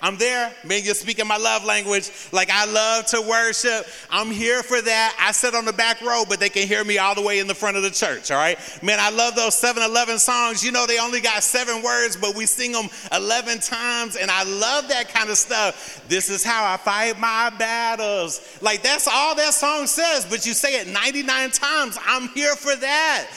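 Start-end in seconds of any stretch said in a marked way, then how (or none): 0.0-8.3 eager worship fan. Acted. Pastor voicing members who love to worship, eagerly. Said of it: "you're already like". 8.8-23.3 higher, quick, gushing congregant; eager worship fan. Acted. Pastor voicing a fan of repetitive worship songs, enthusiastic. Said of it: "you're already like".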